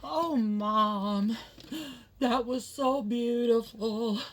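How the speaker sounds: put-on high, loud, slow childlike gush of emotion; child voice. Son telling mom the movie was beautiful, high gushing child voice. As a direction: play the little boy overcome by the ending.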